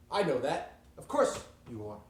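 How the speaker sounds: loud, impatient retort; acted exasperated outburst; irritated boss. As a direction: cut them off with a loud burst. Boss cuts off the scientists.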